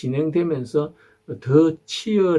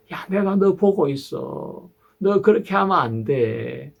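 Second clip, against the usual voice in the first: God's warning; 7 semitones higher than usual. Retold or imitated. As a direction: caution a child gently but firmly. Preacher voices God warning.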